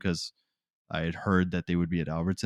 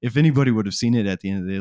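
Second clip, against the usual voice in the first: ordinary talk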